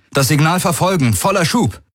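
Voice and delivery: high, urgent order without extra volume; called-out command. A narrator's reading. Man orders the ship to follow the signal, calling out. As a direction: give the order pitched well up. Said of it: "rief Galen".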